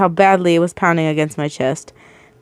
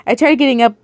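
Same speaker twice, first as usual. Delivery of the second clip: usual speaking voice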